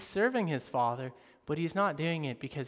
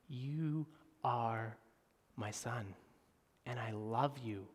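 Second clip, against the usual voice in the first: tender father; 5 semitones lower, 8 dB quieter, slower than usual. Acted. Father's tender words to son.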